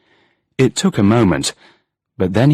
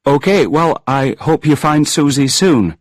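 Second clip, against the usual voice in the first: anxious reply; 7 semitones higher, 3 dB louder than usual. A narrator's reading. Angus ends call, anxious.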